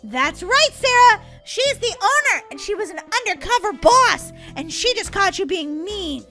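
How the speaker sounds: loud, high gloating announcement; triumphant reveal. Acted. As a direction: rub it in at full voice. Triumphantly reveals the undercover boss.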